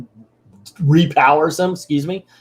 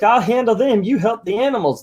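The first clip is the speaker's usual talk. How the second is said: higher-pitched heroic orders; Captain Planet; 8 semitones higher than usual. Retold or imitated. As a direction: take charge like a cartoon superhero. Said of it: "Captain Planet's like".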